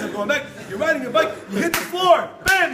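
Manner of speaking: ordinary talk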